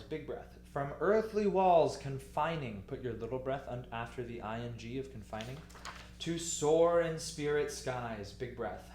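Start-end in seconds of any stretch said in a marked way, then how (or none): 0.7-2.8 slow, louder recitation stressing "earthly"; broad, weighted "earthly", unhurried to "confining"; expressive phrasing. Shown as a demonstration. Teacher demonstrates phrase before little breath. 6.2-8.3 slow recitation with a long "soar"; measured, elongated line leaning on "soar"; expressive phrasing. Shown as a demonstration. Teacher demonstrates phrase before big breath.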